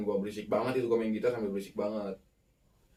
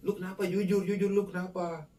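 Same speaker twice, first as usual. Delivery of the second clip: higher-pitched, insistent retold questioning; pressing questioning; 8 semitones higher than usual. Boys press their friend to be honest, pressing and insistent. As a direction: push for an answer in a raised pitch. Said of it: "Akhirnya anak-anak cowok dipaksa".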